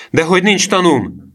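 high, loud protest; boy's outcry. A narrator's reading. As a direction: cry out in indignant contradiction. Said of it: "kiáltotta Emil".

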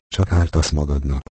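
plain reading voice

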